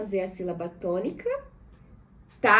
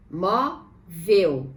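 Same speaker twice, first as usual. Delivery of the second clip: MÓ, pause, VEL; "Móvel" as mó... vel, each part held apart; syllable by syllable; 5 semitones lower than usual. Shown as a demonstration. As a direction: split "Móvel" into two separate syllables. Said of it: "Vamos separar móvel em sílabas".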